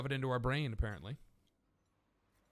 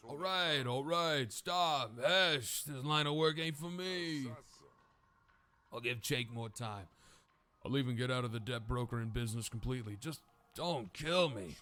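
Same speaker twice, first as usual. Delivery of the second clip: beaten man begging for his life; pleading debt broker. Acted. Defeated broker begs to be spared, pleading.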